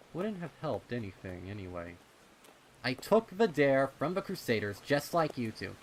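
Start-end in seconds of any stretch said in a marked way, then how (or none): 2.8-5.8 put-on high, loud protesting kid; indignant colt. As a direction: insist you earned your place. Rumble defensive.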